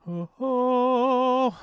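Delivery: high, loud, long "ohh"; excited whoop. Real, not acted. Streamer seeing the search results, excited high whoop. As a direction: let out a sustained high "oh-ohh".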